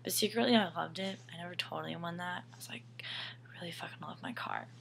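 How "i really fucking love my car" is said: whispered confession; hushed, unvoiced admission; secret whisper. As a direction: breathe it like a secret.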